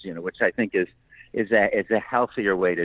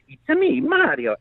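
much higher, cheerful Italian cartoon voice; Mario catchphrase; 3 dB louder, slower than usual. Asked about Mario, he greets in a much higher Italian Mario voice. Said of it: "of course, it is".

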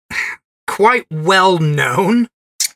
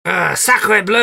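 slow, hammed-up French exclamation; french chef; 5 semitones higher, slower than usual. Joking reply in mock French.

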